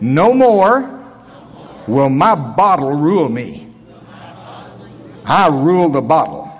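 slow, emphatic repeat-after-me declaration; firm, measured preacher's affirmation; leading a declaration. Real, not acted. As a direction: proclaim it in short phrases for a congregation to echo. Leads congregation in repeat-after-me declaration.

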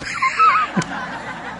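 squeaky burst of laughter; real high laugh. Real, not acted. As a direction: let a high giggle out. Laughter after the punchline.

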